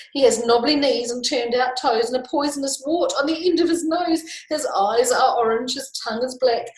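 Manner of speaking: high, loud storybook fright; astonished, frightened mouse voice; mounting alarm. Acted. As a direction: wail the opening, then list in rising panic. Mouse meets the very monster.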